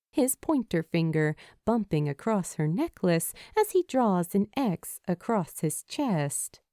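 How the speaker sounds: ordinary delivery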